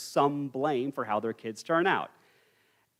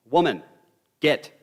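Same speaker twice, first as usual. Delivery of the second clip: loud, abrupt order to the parents; a demanding son's blunt command; curt demand; 2 semitones higher, 7 dB louder than usual. Acted. Samson orders his parents to fetch a wife, curt and loud. Acted demand of spoiled son.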